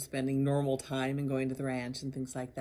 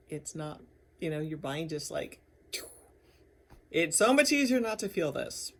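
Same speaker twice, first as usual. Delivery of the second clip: ordinary talk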